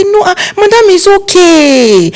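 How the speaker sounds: high, slow it's okay; gracious reassurance. Retold or imitated. Family forgiving the planner on the phone, high slow reassurance. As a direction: let her off gently, drawn out high. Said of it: "They say".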